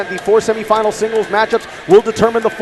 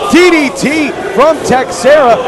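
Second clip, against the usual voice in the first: excited call; 5 semitones higher, 4 dB louder than usual. Real, not acted. Play-by-play call of a move.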